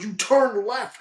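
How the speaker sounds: high, incredulous mock accusation; exasperated accusation. Clubhouse man scolding golfer over the wrong turn, high exasperated accusation. Acted escalating clubhouse argument retold.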